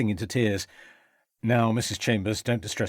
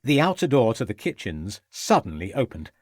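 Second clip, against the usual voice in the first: usual speaking voice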